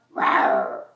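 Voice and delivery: deep, loud growling roar; low dinosaur roar. Pretend. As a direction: drop way down for a big dinosaur roar. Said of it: "roar like a dinosaur".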